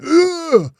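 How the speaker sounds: sharp high grunt of pain; a winded grunt as he hits the floor; mock grunt. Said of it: "he went".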